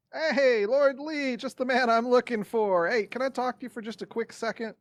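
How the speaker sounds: high, loud friendly hail; calling out. Acted. Player hailing Lord Lee among surrounding guards, calling out.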